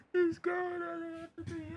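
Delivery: high, tiny tearful squeak; mock wail. Racer on a rival going at year's end, mock crying. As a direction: whimper it in a thin, very soft high voice. Breaks into mock wailing over departure.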